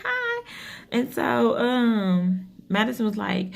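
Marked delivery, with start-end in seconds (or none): 0.0-0.4 high polite hi. Retold or imitated. Mother waves back.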